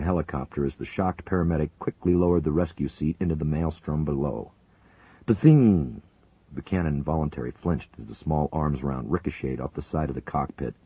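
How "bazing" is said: loud imitated bazing; ricochet. A narrator's reading. Narrator imitating a ricochet, loud. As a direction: fire off the ricochet sound.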